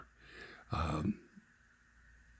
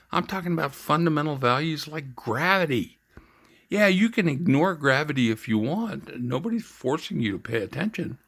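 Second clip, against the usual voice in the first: raised pitch, emphatic comeback; scornful retort; 6 semitones higher, 4 dB louder than usual. Retold or imitated. Veteran correcting the chaplain about fundamental values, scornful retort. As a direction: put her straight with exasperated emphasis.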